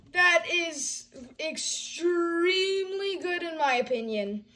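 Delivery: ordinary delivery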